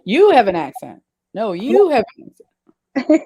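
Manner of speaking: loud, swooping stress on YOU; playfully insist, punching YOU hard and loud; mock argument. Friends joking over who has an accent, mock argument.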